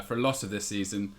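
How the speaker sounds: ordinary talk, nothing marked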